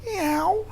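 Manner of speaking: squeaky high kitten mew; a tiny high-pitched cat noise; high cat meow. Retold or imitated. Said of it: "supposed to be the noise".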